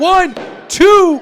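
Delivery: high, loud pin count; excited count. Real, not acted. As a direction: shout the count out.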